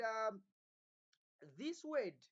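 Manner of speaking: ordinary talk, nothing marked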